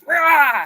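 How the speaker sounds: loud, high mock roar; a hammed-up bear roar. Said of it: "he grabs her and he goes".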